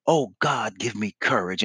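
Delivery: a small private prayer under the breath; quiet prayer. Retold or imitated. Witness praying before speaking.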